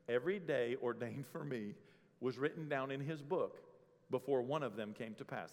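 hushed recitation of a psalm; scripture reading. Acted. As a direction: read the verse softly and reverently. Quoting scripture solemnly.

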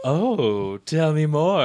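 mock-giddy, drawn-out "oh" swooping up high; lapping it up, playfully. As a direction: imitate someone delighted to hear the gossip. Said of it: "giddy. She was like".

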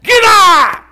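put-on shouted high, raspy old trainer; loud, raspy impression of an old man. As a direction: yell it like an old boxing coach. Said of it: "In that raspy old voice".

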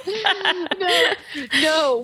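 high laughing denials. Real, not acted. Guest laughing away the water-throwing joke, high. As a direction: laugh off the idea.

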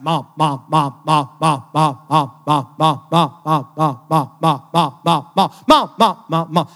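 loud, relentless monotone chant in an imitated kid's pestering; nagging little kid. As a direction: pester mom loudly, evenly, without a break. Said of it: "there's that little kid. He's like".

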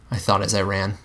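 plain reading voice

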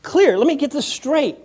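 a hint of a frustrated God; God speaking. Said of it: "He says".

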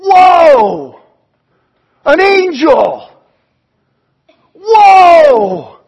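loud, high shout of awe; amazed shepherds. Acted. Shepherds seeing the angel, loud amazed shout. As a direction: gasp out wonder at the angel. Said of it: "the shepherds are going".

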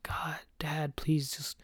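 hushed, breathy plea into the dark; half-asleep whispered plea; groggy whisper. Retold or imitated. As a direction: whisper it so no one wakes. Mutters to dad's ringing phone.

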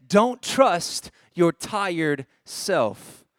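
very slow, phrase by phrase; deliberate, spaced-out quotation; slow, emphatic slogan. Retold or imitated. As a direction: read the sign out with drawn-out weight. Preacher reads out the sign's slogan.

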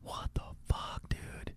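slow, hushed disbelief; stunned whisper. Retold or imitated. Man finding blood on the carpet, stunned whisper. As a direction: whisper it slowly, barely any voice.

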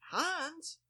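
very high, questioning HANS; puzzled prompt. Retold or imitated. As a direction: say HANS as a high-pitched question to a blank face. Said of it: "the blank stare in my face".

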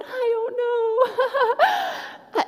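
very high, long-drawn helpless whine; comically clueless, way up high; clueless squeal. Retold or imitated. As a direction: squeak it out, stretching every word. Clueless answer to pastor's question.

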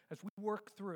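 usual speaking voice

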